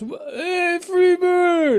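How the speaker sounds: put-on very high, loud drunken holler; drunken heckler. Drunk guy yelling a song request at the band, slurred heckler holler. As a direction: stretch out a piercing drunk yell from the crowd. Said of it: "he was drunk and he goes".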